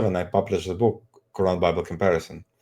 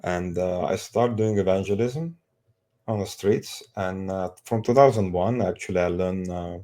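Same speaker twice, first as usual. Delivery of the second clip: Azerbaijani accent. Real, not acted. Speaker born in Azerbaijan.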